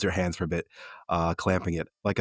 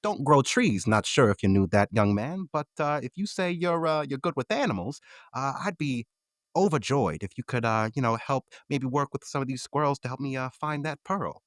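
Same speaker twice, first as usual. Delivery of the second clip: put-on old shopkeeper voice; 8 semitones higher than usual. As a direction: play Mister Creed as a friendly old seed seller. GM voices shopkeeper Mister Creed.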